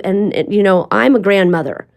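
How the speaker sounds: ordinary talk, nothing marked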